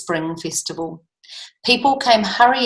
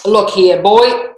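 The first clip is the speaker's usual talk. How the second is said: growled, king; 7 dB louder, slower than usual. A narrator's reading. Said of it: "growled the king".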